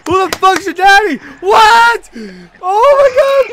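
loud, high gamer yelling; real high-pitched shouting; worked-up screaming. Real, not acted. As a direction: scream it, building to disbelief. Yelling in triumph, then disbelief.

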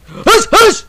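ordinary delivery

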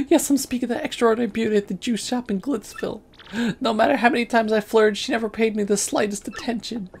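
high melodramatic suitor; lovesick character. Acted. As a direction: pine for her in a high voice.

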